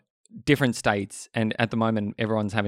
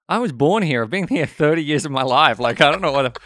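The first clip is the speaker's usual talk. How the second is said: high, louder exasperated protest; mock indignation; 4 semitones higher, 5 dB louder than usual. Australian defending his origins to doubters, mock indignation.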